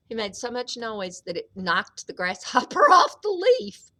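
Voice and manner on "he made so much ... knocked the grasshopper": plain reading voice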